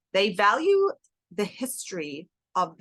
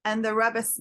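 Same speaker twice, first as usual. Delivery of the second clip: ordinary talk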